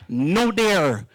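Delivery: ordinary delivery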